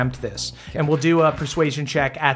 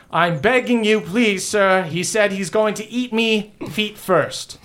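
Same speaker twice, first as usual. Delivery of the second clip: higher, pleading herald's voice; nervous pleading envoy; 6 semitones higher than usual. Acted. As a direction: beg the prince to submit, terrified. Cheswick pleading before the prince.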